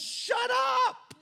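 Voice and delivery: high, loud stern command; love shouting down anger. Retold or imitated. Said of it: "love comes in and says".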